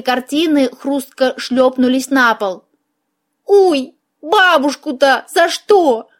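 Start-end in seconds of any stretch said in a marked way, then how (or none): 3.5-6.1 high, drawn-out mock lament; sorrowful exclamation. A narrator's reading. Mouse protests over grandmother's painting, sorrowful exclamation. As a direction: wail it slowly in a higher mouse voice. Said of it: "горестно воскликнула мышь".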